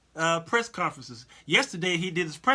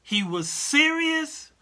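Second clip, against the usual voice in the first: serious drawn out slow and much higher; emphatic serious; 10 semitones higher, 4 dB louder, slower than usual. Shown as a demonstration. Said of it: "It wasn't jest".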